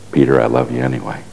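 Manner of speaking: soft, low reassurance; tender forgiveness. Acted. Jesus to Peter after the betrayal, tender forgiveness. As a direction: say it gently, low and quick.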